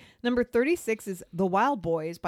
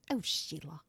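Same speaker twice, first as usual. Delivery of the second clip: low, soft mock Australian accent; 5 semitones lower, 9 dB quieter than usual. Co-host wondering why the band sang it so, soft Australian accent. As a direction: murmur it in an imitated Aussie accent. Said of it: "why they did that accent".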